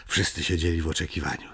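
ordinary delivery

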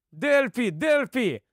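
restrained high cry. A narrator's reading. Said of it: "кричить Албус".

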